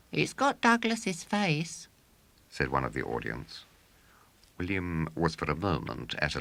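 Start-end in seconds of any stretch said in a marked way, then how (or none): 0.1-1.9 put-on child heckler. A narrator's reading. A child from the audience.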